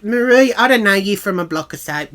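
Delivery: acted-out indignant refusal; indignant retort. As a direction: put her firmly in her place, loudly. Acted doorstep refusal in a quarrel.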